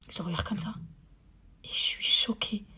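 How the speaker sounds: whispered, stunned; a whispered aside to viewers, stunned; shocked whisper. Real, not acted. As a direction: breathe it out in shock. Stunned after the scene.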